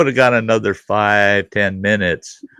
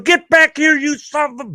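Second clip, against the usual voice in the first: angry yell. Retold or imitated. Dad yelling at his son running out the door, high angry yell. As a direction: call it after him as he runs off. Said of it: "I'm going".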